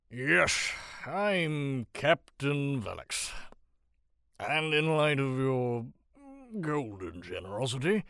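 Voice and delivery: tipsy captain striking a cheeky bargain; drunken rogue captain. A narrator's reading.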